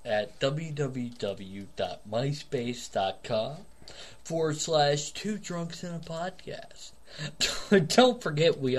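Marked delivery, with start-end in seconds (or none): 0.0-6.9 web address spelled out slowly; slow and deliberate. Shown as a demonstration. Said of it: "Slow it the fuck down".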